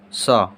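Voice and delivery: "स" as a short hissed s, low; letter sound demo. Shown as a demonstration. Teacher gives pupils the sound "स", short and low. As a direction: model the bare "स" sound. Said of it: "इसका उच्चारण हो जाएगा".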